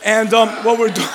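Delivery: ordinary talk, nothing marked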